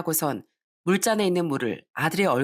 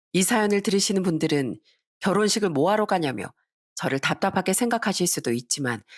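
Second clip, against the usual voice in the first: ordinary delivery, nothing marked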